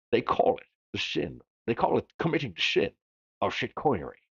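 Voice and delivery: slurred-s Scottish accent, "sin" said as "shin"; Scottish movie-star impression with sh for s; Sean Connery. Shown as a demonstration. Host redoing a quote for fun, Scottish star accent. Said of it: "feels better to say it that way".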